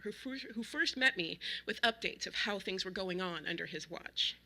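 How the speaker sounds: usual speaking voice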